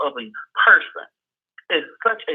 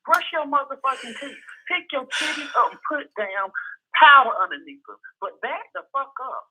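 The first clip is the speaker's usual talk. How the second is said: much higher, hammed-up ranting orders; mock-furious rant; 10 semitones higher than usual. Host ranting at a bored critic, high mock fury. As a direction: bark mock orders at the hater, pitched way up.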